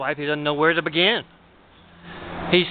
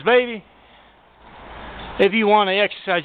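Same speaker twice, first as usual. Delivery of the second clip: lightly voiced wife, pitched higher; wife's voice; 7 semitones higher than usual. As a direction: raise the pitch a little to play his wife. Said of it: "And she says".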